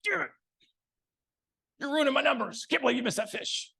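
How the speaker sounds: hammed-up fishing guide snapping over a missed fish; mock yell. Guide Gary mock yelling at the angler over a missed fish. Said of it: "he would yell at me".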